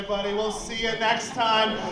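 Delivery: ordinary delivery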